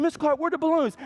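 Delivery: lightly voiced eager children; high kid voice. Kids to their teacher, a high eager question. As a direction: ask it like excited fifth graders.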